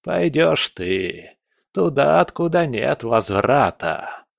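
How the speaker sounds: slow, ominous pronouncement; menacing old spirit. Acted. Spirit sentences captive to doom, slow menace. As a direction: stretch out the doom.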